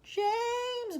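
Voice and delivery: high pleading call; centipede bawling. Acted. Centipede bawling to James for help. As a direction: bawl the name for help. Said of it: "bawled the centipede".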